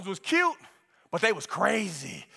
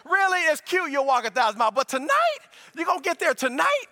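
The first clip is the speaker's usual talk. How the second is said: mock disbelief; 7 semitones higher than usual. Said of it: "I'm like".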